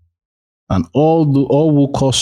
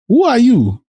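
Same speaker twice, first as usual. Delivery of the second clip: put-on high, louder startled old father; old Isaac asking; 3 dB louder than usual. Blind Isaac asking his son who he is, in a high startled voice. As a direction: ask it like a puzzled blind old man.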